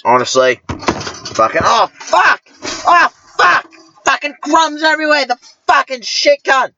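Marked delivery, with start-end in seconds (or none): 1.3-6.7 angry outburst. Acted. Sudden outburst at crumbs.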